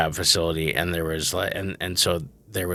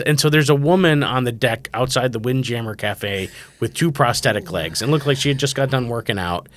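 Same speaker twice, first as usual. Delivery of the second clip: ordinary talk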